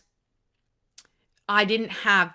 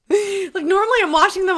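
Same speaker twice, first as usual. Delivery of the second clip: higher and louder, laughing through words; 11 semitones higher, 9 dB louder, faster than usual. Real, not acted. Telling viewers about dishes while laughing. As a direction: laugh it out, amused at herself.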